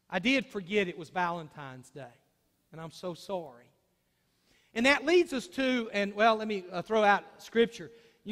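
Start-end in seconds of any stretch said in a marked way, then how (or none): 0.1-3.6 much softer and lower, gently contrite; a hushed, sincere sorry to his wife; soft apology. Pretend. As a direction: own the mistake quietly, tenderly.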